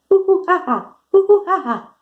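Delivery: high hoots dropping into ha-ha; monkey whoops. Shown as a demonstration. Teacher giving kids a clue for the monkey, whooping. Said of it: "The monkey says hoo".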